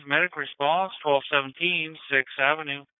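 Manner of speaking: ordinary talk, nothing marked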